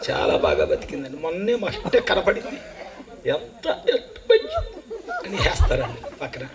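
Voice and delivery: laughing mock of hired mourners' gossip; mocking mourners with a laugh. Preacher mocking hired mourners, laughing. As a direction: chuckle through a lightly voiced mourner's praise.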